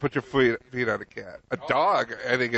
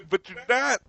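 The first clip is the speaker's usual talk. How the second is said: high teasing voice; 12 semitones higher than usual. Real, not acted. Teasing interjection, high far voice.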